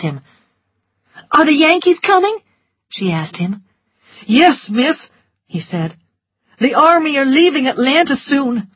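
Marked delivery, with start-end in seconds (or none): 1.3-2.4 high, fast anxious question; anxious Scarlett. A narrator's reading. Scarlett stopping a soldier, quick and high. 4.3-8.7 higher, brisk soldier; soldier's reply. A narrator's reading. Soldier answering Scarlett, brisk and raised.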